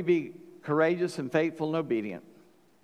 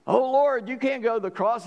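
high, louder pleading protest; protesting Peter; 7 semitones higher, 4 dB louder than usual. Retold or imitated. Peter pleads with Jesus, high and urgent. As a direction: beg him not to go, voice up high.

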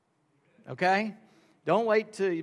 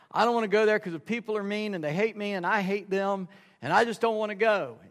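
higher-pitched mock complaint; childish protest; 6 semitones higher, 4 dB louder than usual. Husband refusing to go to church, in mock protest.

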